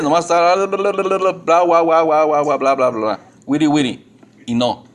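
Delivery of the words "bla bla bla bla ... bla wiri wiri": mock repetitive babble. Preacher mimicking Gentiles' repetitive prayers, mock babbling. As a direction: roll the tongue into endless meaningless chatter. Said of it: "repetitivas como los gentiles".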